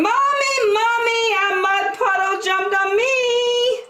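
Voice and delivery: put-on high, upset little-girl cry for mommy; a muddy little girl wailing for her mother; child yelling for mommy. A narrator's reading. Muddy little girl calls her mommy, high and upset. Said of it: "ran inside yelling".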